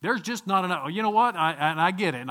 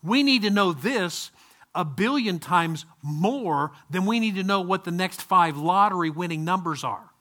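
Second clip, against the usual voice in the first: ordinary talk, nothing marked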